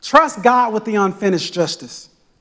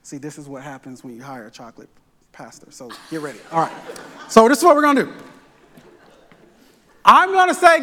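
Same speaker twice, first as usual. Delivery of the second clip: ordinary talk, nothing marked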